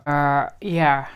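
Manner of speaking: ordinary talk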